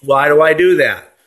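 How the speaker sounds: usual speaking voice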